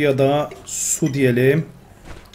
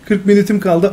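higher, louder mock reading of the phrase; mocking repeat; 6 semitones higher, 5 dB louder than usual. Real, not acted. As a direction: parrot the game's words back. Repeats game text mockingly.

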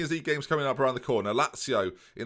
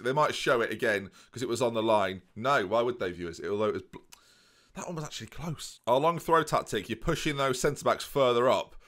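normal talking voice

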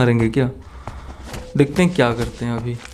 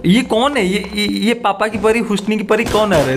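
high startled yelp at a sudden zombie; 7 semitones higher than usual. Real, not acted. Gamer spots a zombie coming at him, startled high yelp. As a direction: jump up in pitch, caught off guard.

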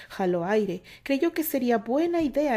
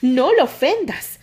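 loud, slow, higher retort; shouting back; 5 semitones higher, 11 dB louder, slower than usual. A narrator's reading. Candy shouts back at Terry to stop insulting him, loud and higher. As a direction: defend him loudly, leaning on lo. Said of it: "Candy también gritó".

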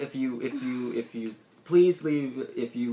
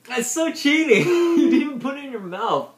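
laughing, high and loud; 13 semitones higher, 7 dB louder than usual. Real, not acted. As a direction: laugh out the accusation. Laughing accusation of cheating.

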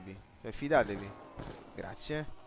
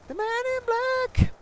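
squeaky announcer; 5 dB louder than usual. Retold or imitated. Gamer parroting the announcer in a very high voice. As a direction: squeak it out like a cartoon.

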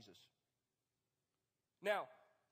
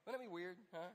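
ordinary talk, nothing marked

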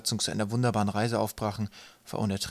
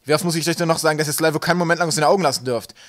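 loud, raised-pitch telling-off; angry scolding; 6 semitones higher, 10 dB louder than usual. A narrator's reading. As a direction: lay into the crew like an exasperated captain. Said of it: "fuhr Wolkenwolf ihn an".

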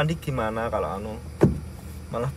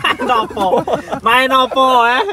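excited; 9 semitones higher, 7 dB louder than usual. Real, not acted. Pressing question, rising loudness.

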